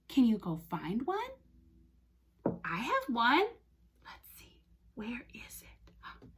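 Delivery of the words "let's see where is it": hushed, barely voiced muttering; searching whisper. Teacher looks for her toy cow, searching whisper. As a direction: whisper as you hunt around.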